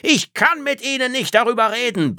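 viel höher und lauter gebrüllt; brüll es voller Frust heraus; brüllend. Acted. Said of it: "brüllte Phil". (Phil brüllt Tretzab an)

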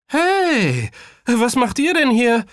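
high, loud call to friends; surprised greeting. A narrator's reading. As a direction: call out brightly to classmates you just spotted. Kaya calls out to classmates. (überraschter, lauter Zuruf)

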